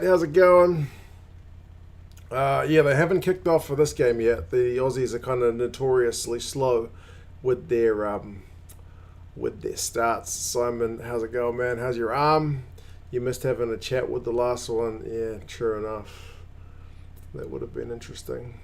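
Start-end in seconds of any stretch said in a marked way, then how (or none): none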